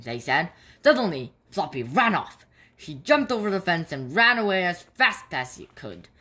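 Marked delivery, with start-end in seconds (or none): none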